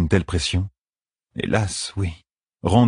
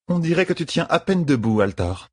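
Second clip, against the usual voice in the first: high-pitched woman's character voice; Merise's voice; 9 semitones higher than usual. A narrator's reading. Woman at the door sizes up weary Rand, high voice. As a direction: pitch it well up, sizing someone up.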